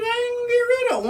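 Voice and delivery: high-pitched, quick insistence. Acted. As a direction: refuse in a high, hurried voice. Acting out redneck keeping his wood.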